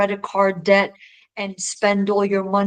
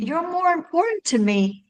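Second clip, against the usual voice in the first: put-on pitched much higher, like a little boy; child's plea; 8 semitones higher than usual. Little son assuring his mom, much higher. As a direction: lift it up high, a young son insisting.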